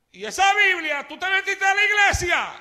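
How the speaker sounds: mocking old friend. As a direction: scoff at the new believer. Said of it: "empezó a reírse de mí".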